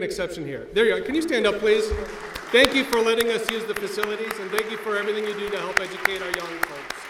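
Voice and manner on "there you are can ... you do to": calling out. Real, not acted. Calling to Patricia across hall.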